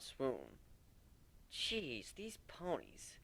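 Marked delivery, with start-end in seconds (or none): none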